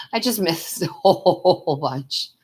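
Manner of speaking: laughing it out, slowly, stretching the whole; giggling through the words at her own slip. Real, not acted. To viewers after missing their chat, laughing.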